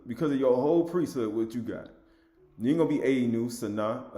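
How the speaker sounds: normal talking voice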